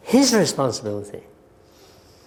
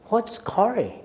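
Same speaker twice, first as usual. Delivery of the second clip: puzzled question; 6 semitones higher, 3 dB quieter than usual. Retold or imitated. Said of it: "I turned to this very well-known thoracic surgeon and said".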